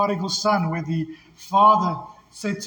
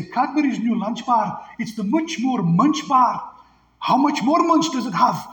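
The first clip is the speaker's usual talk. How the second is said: higher, hammed-up Scottish advert voice; Scottish accent; 4 semitones higher than usual. Recalling an old chocolate bar commercial for the congregation, Scottish accent. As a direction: put on a Scottish ad announcer's lilt. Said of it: "advertised with a Scottish accent".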